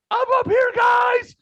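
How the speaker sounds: very high, loud shout across a room; imitated calling out. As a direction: mimic her yelling down from the top row. Girl calls from top row.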